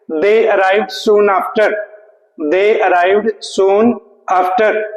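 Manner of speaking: very slow dictation pace; slow sentence read. Shown as a demonstration. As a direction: read each word slowly for the class. Reading sentence slowly. (बहुत धीमी गति से वाक्य पढ़ना)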